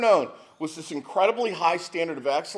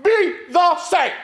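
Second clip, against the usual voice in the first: laughing mock-cry of an eleven-year-old; mock wailing; 13 semitones higher than usual. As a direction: imitate a heartbroken kid sobbing, much higher. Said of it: "He goes".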